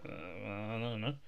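slow unsure mumble; hesitant Doctor Strange. Retold or imitated. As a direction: drag it out, unsure. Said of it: "Doctor Strange is like".

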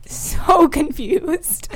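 laughing through the words, pitch up; can't hold back the giggles. Real, not acted. Telling friends about the odd stranger, laughing. Recalling baffling encounter.

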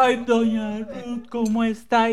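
high, drawn-out gushing hello; effusive greeting. Retold or imitated. Mother runs into doña Ruth in Chepe, effusive. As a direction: imitate a chatty mother greeting a friend.

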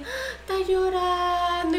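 high, slow, drawn-out mock pity; long pitying coo over the words; cooing sympathy, mocked. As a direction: imitate a touched old churchgoer. Voicing churchgoers pitying her.

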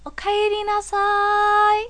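high, drawn-out Japanese anime mother greeting. Pretend. Cohost asks for a welcome home, mother voice. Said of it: "in the mother voice".

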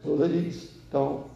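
soft, wet gurgle through the words; a dying boy's last gurgled plea; gurgling voice. Retold or imitated. Dying young man's last words to the minister, soft gurgle. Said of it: "in a gurgling voice".

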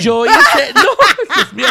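high, loud, slow laugh through the words; real laughing. Real, not acted. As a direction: crack up mid-sentence. Cracking up at accusation.

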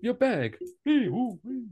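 high-pitched performer's patter; lightly voiced showman mid-trick; a hint of a high busker voice; juggling busker. Plays the juggler calling for props.

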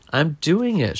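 understated shout of pride; proud shout. A narrator's reading. Daniel finally rides his bike, mild proud shout. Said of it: "shouted Daniel proudly".

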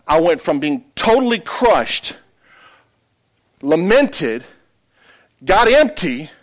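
high, loud, climbing sermon delivery; full-force preaching. Acted. Pastor testifies to the congregation about his healing, full-force preaching.

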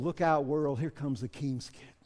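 low, soft sincere declaration; hushed, sincere promise; quiet confidence. Shown as a demonstration. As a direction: say it softly, with quiet assurance. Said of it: "we can honestly say to the world".

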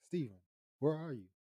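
retold calling out. Retold worried call from Jessica.

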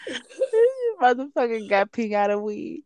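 giggling through the words; cracking up mid-sentence; laughing. Real, not acted. Laughing at friend's weed story.

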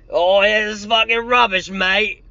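hammed-up Cockney complaint; mocking a mate's London accent; mock Joe impression. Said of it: "as Joe would say".

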